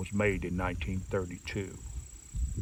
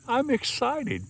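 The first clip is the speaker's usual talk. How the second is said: real excitement, pitch jumping much higher; excited. Real, not acted. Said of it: "Forgive me for getting a little excited".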